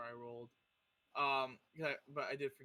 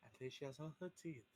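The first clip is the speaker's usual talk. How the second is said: soft, private in-character remark; quiet aside, kept to himself; 10 dB quieter, faster than usual. Acted. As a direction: say it under your voice, to yourself. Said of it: "he says to himself".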